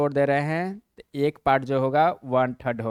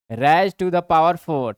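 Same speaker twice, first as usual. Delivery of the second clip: slow systematic reading; 5 dB louder, much slower than usual. Shown as a demonstration. Teacher showing the formal way to read it, slow and clear. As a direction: raise to the power four drawn out slowly. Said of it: "और systematic पढ़ना है तो".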